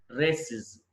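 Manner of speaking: రేసిస్ as "ray-siz"; "-iz" ending. Shown as a demonstration. Teacher correcting learners' plural, రేసిస్ as "ray-siz". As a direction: end రేసిస్ on a short i and buzzed z. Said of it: "ఇజ్".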